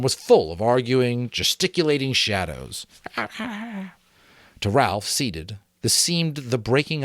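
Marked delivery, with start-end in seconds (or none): none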